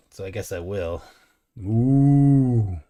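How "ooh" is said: loud, drawn-out cow moo; playful moo. Pretend. Streamer obliging the chat's request, loud cow moo. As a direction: moo long and loud, higher than usual. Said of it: "trying to get me to say it".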